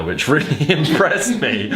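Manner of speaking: high, slowed line breaking into laughter; laughing while reading. Real, not acted. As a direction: crack up halfway through the line. Reader cracks up mid-line.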